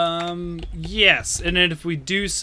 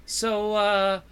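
higher-pitched, antsy suspect; hesitant, higher voice angling to get away; uneasy character; 5 semitones higher than usual. Acted. Tulio asks his questioners if he can leave, uneasy and higher-pitched. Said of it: "shifts in his seat".

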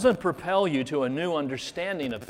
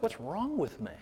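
softer, murmured to himself; quiet inner question; 5 semitones higher, 6 dB quieter than usual. Retold or imitated. Preacher voicing his own private doubt, softly to himself. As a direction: ask it under your breath, half to yourself.

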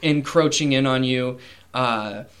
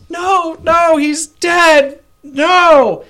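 far higher, louder, slow anguished wail; queen screaming; 9 dB louder, much slower than usual. Acted. Queen over her dead son, screaming in grief. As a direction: scream out a mother's despair. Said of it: "the queen screams".

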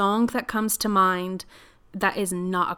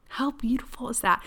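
whispered in wonder, voice unvoiced; awed whisper; 4 semitones higher than usual. Real, not acted. Moved by the post she read to listeners, whispering. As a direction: breathe it out as a hushed aside. Awed aside after reading quote.

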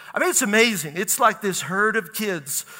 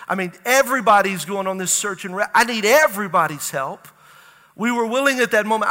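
usual speaking voice

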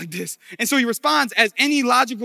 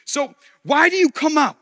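loud, high declamation; Goliath's challenge; 8 semitones higher, 8 dB louder than usual. Acted. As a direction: call the challenge out across the lines.

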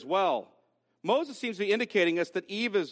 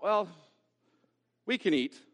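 hesitant "well", then understated; lukewarm concession. Acted. Eve dropping the emphasis, lukewarm and hesitant. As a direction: concede it half-heartedly, with a pause. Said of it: "It sounds like this".

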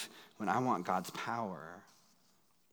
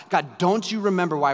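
louder, higher anguished cry; a hammed-down retold scream at God; 6 semitones higher, 7 dB louder than usual. Pastor retelling crying out to God on the drive, loud and high. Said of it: "audibly screaming to God".

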